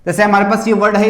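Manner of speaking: usual speaking voice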